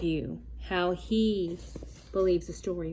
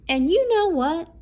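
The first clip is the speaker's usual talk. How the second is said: higher, louder, eager; excited reveal; 8 semitones higher, 8 dB louder than usual. Acted. Wolf teasing the big reveal, excited and loud. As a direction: build up the surprise.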